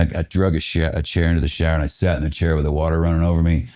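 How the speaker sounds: ordinary talk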